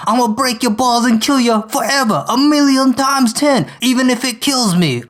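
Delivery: high-pitched, hammed-up Brooklyn accent rant; Eddie Brock, Brooklyn accent. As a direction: vow revenge in an overwrought, amateur Brooklyn accent. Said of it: "hear my poor Brooklyn accent".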